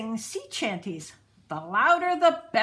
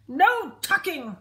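higher, louder chorus; crew crying out; 7 semitones higher, 6 dB louder than usual. A narrator's reading. Crew backing the captain's refusal, loud cry. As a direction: shout it as the whole crew. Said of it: "the crew cried".

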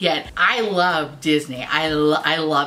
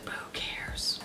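whisper; 15 dB quieter, slower than usual. Real, not acted. Whispered aside.

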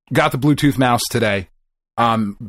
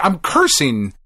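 ordinary talk

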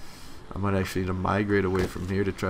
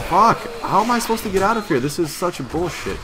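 high, loud, fast gamer rage; real outburst of frustration; frustrated shout; 10 semitones higher, 7 dB louder than usual. Real, not acted. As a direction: yell in exasperation at being trapped. Trapped in house, raging at game.

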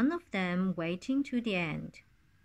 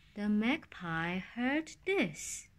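plain reading voice

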